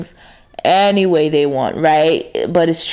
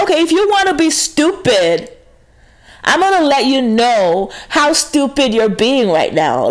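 sassy retort; 5 semitones higher than usual. Retold or imitated. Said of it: "And I'm like".

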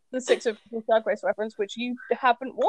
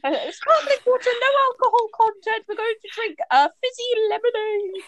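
high laughing posh voice; mock posh teen; 11 semitones higher than usual. Joining the mockery of the carefree sixth formers, laughing posh voice. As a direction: ham up the prissy drinker. Joins the mocking posh impression.

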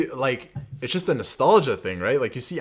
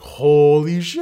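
loud, drawn-out, higher-pitched mock amazement; awed crowd exclamation; 5 semitones higher, 11 dB louder, much slower than usual. Fan retelling the theater's reaction to a friend, loud awed exclamation. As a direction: stretch it out like a stunned audience. Acting out the stunned audience's reaction.

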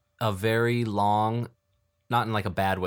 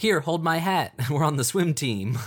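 put-on high-pitched kid bravado; excited little girls; 6 semitones higher, 4 dB louder than usual. Hostage girls about to dive in, excited high voice. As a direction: pipe up like an eager little girl. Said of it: "taking the little girls as hostages".

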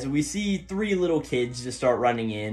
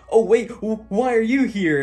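surprised; 10 semitones higher, 6 dB louder than usual. Retold or imitated. Nito surprised to meet Taiga.

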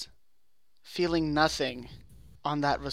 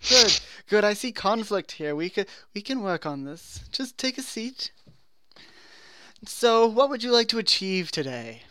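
therapist voice; 6 semitones higher than usual. Acted. [name] therapist voice.

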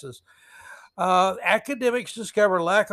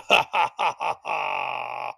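hammed-up supervillain laugh; staccato "ha-ha-ha" trailing into a long last note; mock evil cackle, low; 4 semitones lower than usual. Villain laugh after quote.

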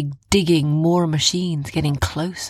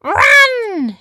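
very high, loud cry of alarm; panicked yell; 7 dB louder than usual. A narrator's reading. Heroine ordering everyone to flee, panicked high yell. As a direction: scream the warning out. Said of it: "Jumba panicked".

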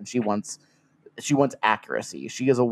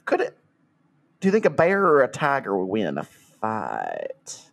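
put-on Southern accent. Customer complains to the shop about missing tigers, in a Southern accent. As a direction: lay the Southern accent on thick, like a trailer-park customer. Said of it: "That was an amazing Southern accent".

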